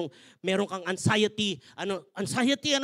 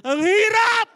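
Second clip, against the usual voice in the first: higher and louder, fed up; exasperated cry; 10 semitones higher, 10 dB louder, much slower than usual. Acted. Pastor groaning to the congregation about marriage, loud and exasperated. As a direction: throw up your hands and cry it out.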